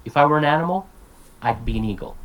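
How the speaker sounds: "If I were an animal" run together, rising, then pause and "I'd be an eagle" falling; each half like one word, break after "animal"; two chunks. Shown as a demonstration. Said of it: "It's like one word".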